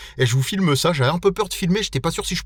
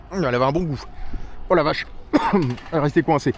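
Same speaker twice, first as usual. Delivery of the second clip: usual speaking voice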